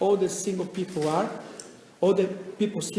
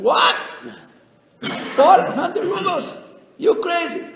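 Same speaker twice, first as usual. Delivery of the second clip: high, much louder, slow, with a laugh; incredulous shout; 10 semitones higher, 9 dB louder, slower than usual. Acted. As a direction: yell it in mocking disbelief. Seller hears outrageous price.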